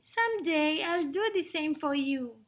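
high, grateful mouse voice; little mouse, grateful. Acted. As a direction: thank the lion as a tiny mouse.

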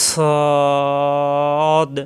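"সদ" with a heavy, long-held vowel; articulation demo. Shown as a demonstration. Teacher shows where tongue meets teeth, drawn-out "সদ". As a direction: draw out "সদ" on one steady note. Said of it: "মাখরাজটা আগে শিখি".

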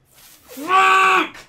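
high, loud curse; furious shout. Real, not acted. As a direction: spit it out screaming high. Gamer falls.

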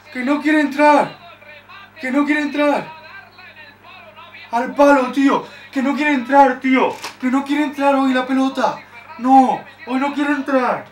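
loud, high and slow; frustrated shouting. Real, not acted. Fan watching the ball hit the post, shouting in frustration. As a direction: bellow it at the screen in disbelief.

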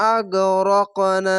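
slow recitation, hold না three beats; আগ রক না ending on a long steady না; three-alif madd. Shown as a demonstration. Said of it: "তিন আলিফ টেনে পড়তে হবে". (না তিন আলিফ টেনে পড়া)

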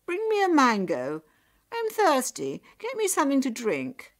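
loud, high demanding call; high-pitched, imperious holler; calling out orders. A narrator's reading. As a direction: call out bossily for service. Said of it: "she called out to her sister".

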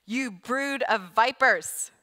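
loud, slow condemning call; John the Baptist rebuking the Pharisees, loud and slow; strong rebuke. Acted. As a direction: thunder it at the crowd like a prophet. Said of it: "He's talking very strong".

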